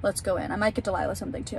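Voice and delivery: normal talking voice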